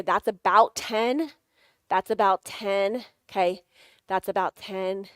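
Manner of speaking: slow, measured count of taps. Shown as a demonstration. Coach pacing a type A person, slow count. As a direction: count it out slowly and steadily. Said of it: "I usually take my other hand and say".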